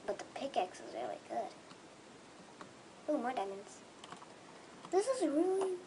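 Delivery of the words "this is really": high, loud, fast excited shriek; overjoyed high-pitched yelling; ecstatic squeal. Real, not acted. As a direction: squeal with joy. Overjoyed at the loot.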